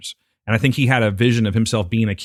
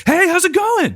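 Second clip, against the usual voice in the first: high, loud, bubbly barista greeting; overexcited barista; 4 dB louder than usual. Retold or imitated. Friend mimics a peppy barista at dawn, way too upbeat. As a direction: chirp it like a too-eager barista.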